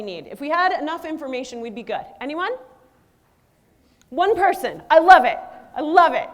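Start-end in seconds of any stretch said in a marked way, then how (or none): 4.9-6.3 real delight, said loud; excited. Real, not acted. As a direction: cheer the one person who raised a hand. Reacting to an audience answer.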